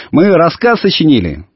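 put-on mild high boy's call; lightly acted holler; calling back. A narrator's reading. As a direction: announce it proudly to a sister far off. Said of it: "закричал в ответ Иван".

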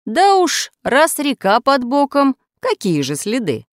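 high-pitched, slow dismissive reply; accomplice's retort. A narrator's reading. Accomplice answers about leaving no traces, high drawn-out retort.

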